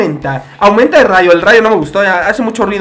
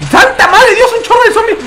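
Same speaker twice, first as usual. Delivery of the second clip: startled cry; 13 semitones higher than usual. Real, not acted. Gamer spotting a flood of zombies, startled high cry. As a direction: cry out at the sudden horde. Sees a huge zombie wave.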